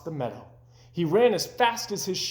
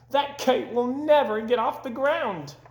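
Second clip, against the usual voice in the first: jeering robins; 9 semitones higher than usual. Acted. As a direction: sneer it out high.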